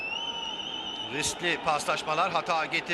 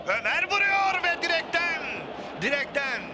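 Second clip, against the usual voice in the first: excited near-miss call; 8 semitones higher than usual. Real, not acted. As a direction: shout the shot hitting the post. Shot hits the post.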